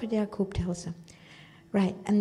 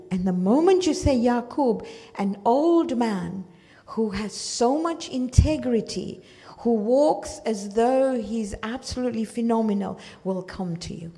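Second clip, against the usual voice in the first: normal talking voice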